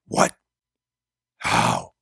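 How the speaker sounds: low, breathless stunned question; stunned gasp. Acted. Gabriel dumbfounded.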